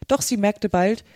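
plain reading voice

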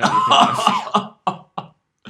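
high, very slow squealed line; mock squeal. Host teases the listeners in a high drawn-out squeal. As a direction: stretch it out in mock despair.

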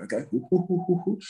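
half-hearted monkey calls, rising up; hesitant monkey hoots. Retold or imitated. Pupil trying out monkey hoots, tentative and rising. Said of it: "And you'd be like".

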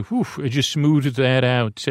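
ordinary talk

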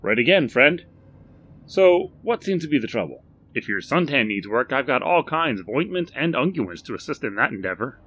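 hearty shopkeeper greeting; Barry's eager voice. Acted. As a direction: chirp it like a keen salesman. Shopkeeper bantering with stranger.

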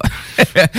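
short high chuckle; high laugh. Real, not acted. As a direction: laugh at the absurd idea. Laughs at strangers on Zoom. (petit rire aigu)

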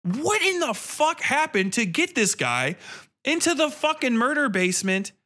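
ordinary talk

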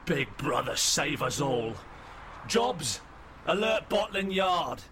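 a hint of a horrified police commander; alarmed officer. Lead officer reacts in horror, calls orders.

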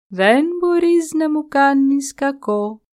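slow, calm, untouched by the attack; the tree calmly daring the buffalo, slow and unshaken; unruffled tree. A narrator's reading. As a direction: say it slowly, fearless and serene. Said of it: "ατάραχο, του είπε".

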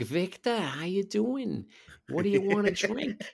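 put-on high, drawn-out Boston accent; Boston woman impression. Woman offering a friend a drink, high Boston accent. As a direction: imitate her high Boston greeting. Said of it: "She'd always be like".

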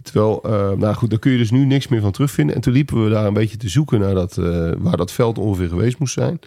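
ordinary delivery

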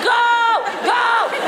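high excited yell; cheering shout. Retold or imitated. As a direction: scream it on like a fan. Cheering the iguana on.

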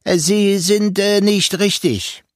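high, pointed, sing-song voice that mocks each word; sharp melodic voice. A narrator's reading. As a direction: lift it up high and lilting, teasing. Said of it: "Diese Stimme klang anders, spitzer und melodischer".

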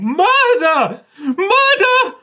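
high, full-force theatrical scream; screaming in shock. Acted. Sir Nigel finding a body in the crowd, screaming in shock. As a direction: scream the alarm, drawn out and very high. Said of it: "he starts screaming".

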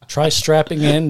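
laughing through words. Real, not acted. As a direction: giggle as you say it. Laughing at own slip.

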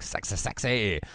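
a hint of a sleazy guy; creepy guest. Mocking the creepy party guest. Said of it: "He's all like".

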